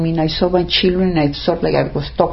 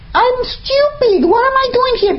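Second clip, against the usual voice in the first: squeaked up high in despair; high anguished self-scolding. Retold or imitated. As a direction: berate yourself in a thin high voice.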